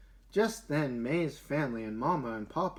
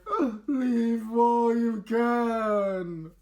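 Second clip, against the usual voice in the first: high, slow, pleading warning; warning passers-by; 8 semitones higher, 5 dB louder, much slower than usual. Acted. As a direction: urge her to turn back, drawn out. Passers-by warn during flood.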